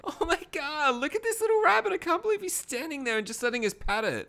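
put-on very high, airy ditzy girly voice; ditzy girls. Pledge girls cooing over a little rabbit, very high ditzy voice. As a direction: coo at the rabbit like an airhead.

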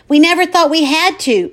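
high, loud outburst; exploding. Acted. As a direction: burst out at full volume. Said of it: "my mother explodes".

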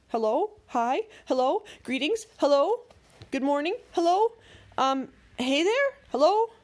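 nervous greetings climbing from mild to high, loud calls; flustered greetings. A narrator's reading. Dwayne trying many replies.

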